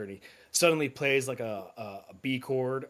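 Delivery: ordinary delivery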